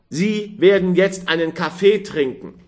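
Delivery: doctor orders a drink firmly. A narrator's reading. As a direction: insist like a doctor. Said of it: "rief der Mann Karl".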